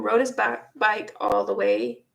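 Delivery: ordinary talk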